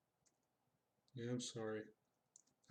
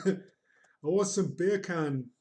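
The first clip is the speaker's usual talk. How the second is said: put-on Jamaican accent; 3 semitones higher, 5 dB louder than usual. Streamer asking for beer can, attempted Jamaican accent. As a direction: draw it out in mock Jamaican. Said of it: "never attempt a Jamaican accent".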